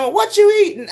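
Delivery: high, playful imitation of the guy; teasing man. Mimicking the stranger asking what she eats, high and playful. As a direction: tease like the stranger. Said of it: "he kept saying".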